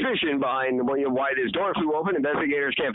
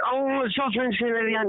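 played-up French Canadian accent; 9 semitones higher than usual. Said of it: "I think she plays it up a bit".

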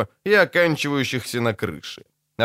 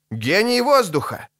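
mild shout; 8 semitones higher than usual. A narrator's reading. Priest identifying the spirit outside the window, mild high shout. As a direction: call it out in astonishment, higher. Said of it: "крикнул Крэп".

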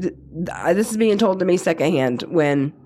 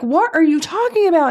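exasperated asking; 9 semitones higher than usual. Retold or imitated. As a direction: push him to make sense. Wife questioning crying husband.

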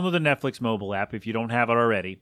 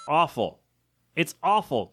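ordinary delivery

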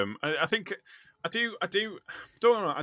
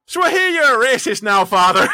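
loud, laughing mock Irish accent; imitating an Irish islander in a broad Irish accent, loud and laughing; 12 semitones higher, 14 dB louder than usual. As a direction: belt it out in a hammed-up Irish accent, cracking up. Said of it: "my best Irish accent there".

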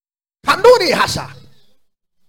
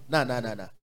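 normal talking voice

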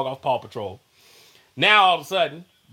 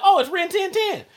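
put-on delighted kid; 14 semitones higher than usual. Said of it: "and I be like".

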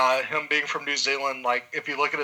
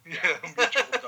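laughing; 5 semitones higher, much faster than usual. Real, not acted. Repeats the joke, laughing.

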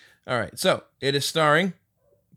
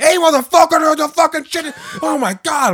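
higher, louder road-rage shout; driver yelling at honker; 10 dB louder than usual. Retold or imitated. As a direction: bark at the car honking behind. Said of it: "He's like".

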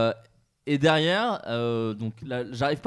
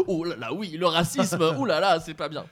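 "Oh là là" sung out higher and louder, mock-scandalised; exaggerated fake shock; 3 semitones higher, 5 dB louder than usual. Shown as a demonstration. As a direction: ham up the dismay over "le racisme". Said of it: "Tu dis pas". (indignation surjouée, plus aiguë et plus forte)

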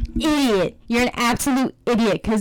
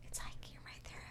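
breathy full whisper; 27 dB quieter, faster than usual. Shown as a demonstration. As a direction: drop to a whisper. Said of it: "I didn't say whisper".

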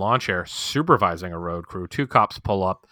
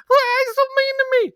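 mock crying; 6 dB louder than usual. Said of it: "She starts crying".

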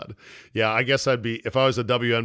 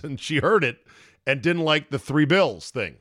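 normal talking voice

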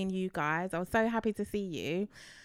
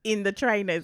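ordinary talk, nothing marked

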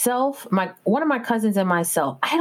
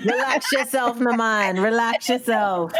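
higher, slow, easy Jamaican lilt; slow, higher relaxed Jamaican accent; Jamaican patois; 4 semitones higher, slower than usual. Retold or imitated. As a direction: tell them to chill island style. Mock island calm.

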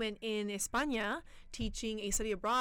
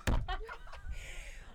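teary laugh; 11 semitones higher than usual. Real, not acted. Laughs through the emotion.

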